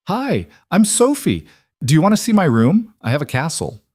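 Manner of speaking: put-on bright little kid lighting up; excited little girl. Excited little girl greets guests.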